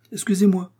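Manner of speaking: very high, quick sorry; hurried apology. A narrator's reading. D'Artagnan emerging from the cloak, apologising in a rush. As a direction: pop out with a rushed sorry. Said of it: "dit D'Artagnan".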